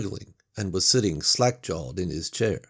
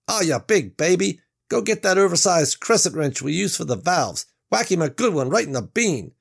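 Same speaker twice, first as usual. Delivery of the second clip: higher, scoffing rough guy; mocking tough Reggie; 9 semitones higher, 5 dB louder than usual. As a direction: needle him, big-mouthed. Reggie mocks Mac.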